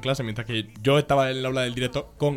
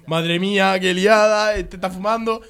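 raised, higher-pitched exclamation; mock dismay; 5 semitones higher, 5 dB louder, slower than usual. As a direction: imitate a scandalised teacher. Retelling the teacher's shocked exclamation.

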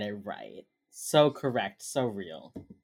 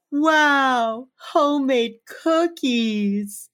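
high, drawn-out mock wonder; exaggerated awe; 10 semitones higher, 5 dB louder, much slower than usual. [name] turning around at a plate of cookies, awed. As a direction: marvel in a stretched, high-pitched voice.

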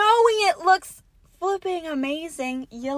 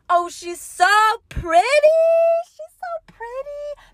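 mock self-admiring; 12 semitones higher, 4 dB louder than usual. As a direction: coo it at yourself. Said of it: "captioned that fucking picture with".

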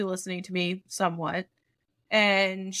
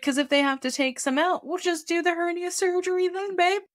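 condescending doctor; 9 semitones higher than usual. Retold or imitated.

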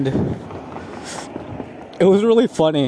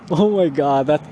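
usual speaking voice

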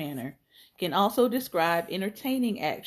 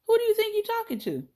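stern teacher challenge; faster than usual. Acted. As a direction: demand an answer from the back-talker. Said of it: "Miss Parks questioned".

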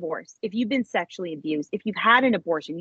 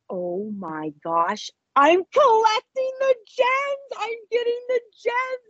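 much higher, slow excited squeal; thrilled realization; slower than usual. Retold or imitated. Woman realizes her dream came true, thrilled squeal. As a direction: let it dawn on you with joy.

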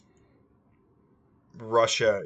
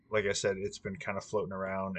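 ordinary talk

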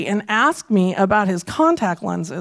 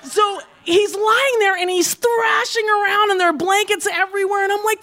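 ordinary talk, nothing marked

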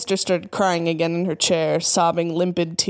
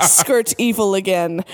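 ordinary delivery, nothing marked

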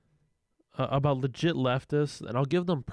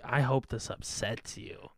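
mock troll; 3 semitones lower, 6 dB quieter, slower than usual. Imitating conservative trolls.

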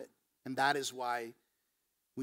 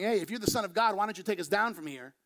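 mocking, louder and higher jeer; taunting; 5 semitones higher, 5 dB louder than usual. Criminal on the cross mocking Jesus, a louder jeering taunt. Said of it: "is kind of taunting Jesus".

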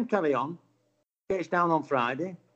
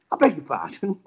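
mild well-spoken affront; 3 semitones higher, 3 dB louder than usual. Retold or imitated. Older angler questioned by stranger, well-spoken and taken aback. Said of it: "and very well spoken".

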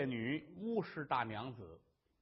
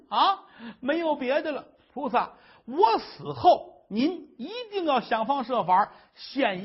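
loud, high tearful plea to the Bodhisattva; desperate wife's prayer; 5 semitones higher, 8 dB louder than usual. Acted. Wife cries out her despair to the Bodhisattva, loud and tearful. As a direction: pour out grief and despair before the statue, voice breaking.

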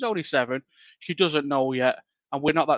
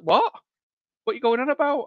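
shocked; 9 semitones higher than usual. Retold or imitated. Husband stunned that wife says there's no curriculum, high shocked outburst. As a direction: blurt it out in disbelief. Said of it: "I was really shocked".

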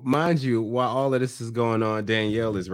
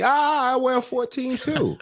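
mock surprised guy; 12 semitones higher than usual. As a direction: jump way up in pitch like a guy caught off guard. Mocking Johan comparing shoe sizes.

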